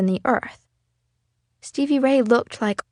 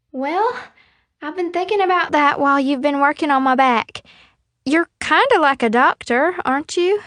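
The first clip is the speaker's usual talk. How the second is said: put-on higher, louder playful girl; 7 semitones higher, 3 dB louder than usual. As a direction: tease him sweetly. Stevie Ray teases Darius.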